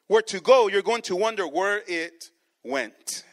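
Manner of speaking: normal talking voice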